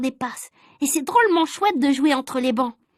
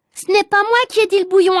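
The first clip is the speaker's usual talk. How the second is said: higher, louder tearful denial; shouted denial; 7 semitones higher, 5 dB louder than usual. Acted. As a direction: protest his innocence loudly. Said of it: "a crié Agnan".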